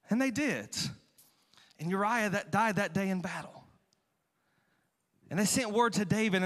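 ordinary talk, nothing marked

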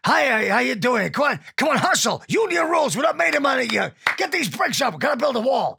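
loud, high, barking American foreman; angry builder voice. Shown as a demonstration. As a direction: bark orders like a gym coach. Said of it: "what about something not quite as angry?".

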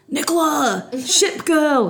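high, carrying call across a noisy bar; playful shouted greeting from afar; calling out her name. Retold or imitated. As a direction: sing out the nickname to get her attention.

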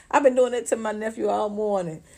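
normal talking voice

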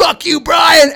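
high-pitched shout; mock angry crowd holler; imitated crowd jeer. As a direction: yell abuse like a rowdy audience member.

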